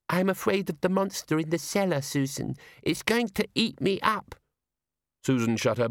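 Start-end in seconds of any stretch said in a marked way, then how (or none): 0.1-4.3 put-on high, sugary child voice; cute little girl. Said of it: "trying to sound ingratiatingly cute".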